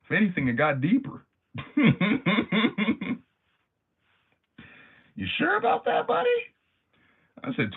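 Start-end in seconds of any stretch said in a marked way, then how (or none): 1.5-3.2 squeaky high laugh, real. Real, not acted. Streamer cracking up at his impression, high squeaky laugh. 5.1-6.3 very high, loud squeaky teasing question; mock squeaky voice. Streamer teasing the boy's excuse, squeaky high mocking voice.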